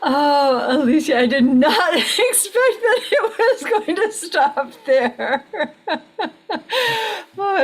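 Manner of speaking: high, loud laughing disbelief; laughing in surprise. Real, not acted. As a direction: laugh through the words, caught off guard. Surprised by the story's ending.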